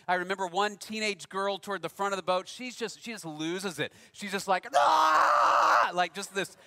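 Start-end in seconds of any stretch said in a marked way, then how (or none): 4.8-5.9 loud, high imitated scream of panic; primal scream. Teenage girl on a stormy boat, primal scream. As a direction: let out a sudden terrified shriek. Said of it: "terrible primal scream".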